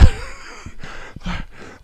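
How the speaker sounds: short unvoiced giggle; high breathy laugh. Real, not acted. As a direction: laugh in disbelief. Laughs at the memory.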